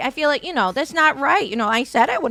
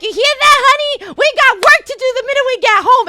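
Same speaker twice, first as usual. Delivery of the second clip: high, loud, eager call across the room; loud playful tease to husband; teasing shout to husband; 11 semitones higher, 6 dB louder than usual. Retold or imitated. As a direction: crow it at him gleefully. Wife loudly teasing husband in clinic.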